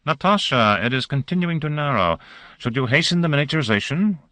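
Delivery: calling out. A narrator's reading. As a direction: call it across the cabin. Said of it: "he turned his head and called out".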